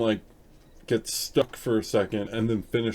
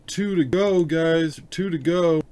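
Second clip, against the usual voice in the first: louder and higher, cheering the viewers on; upbeat cheer; 7 semitones higher, 7 dB louder, slower than usual. Real, not acted. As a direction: count down the last two with a grin. Cheering progress to stream viewers.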